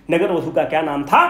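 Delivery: ordinary delivery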